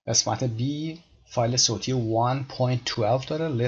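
usual speaking voice

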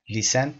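"Listen" mispronounced as "leesen"; wrong model of "Listen" with long ee. Shown as a demonstration. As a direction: say "Listen" with a tense ee in front. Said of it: "اشتباه هست".

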